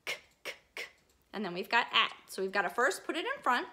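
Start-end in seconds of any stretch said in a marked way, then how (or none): 0.0-0.8 "K, k, k" as a whispered unvoiced k k k; k sound. Shown as a demonstration. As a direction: breathe out "K, k, k" as bare k sounds. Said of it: "You're right".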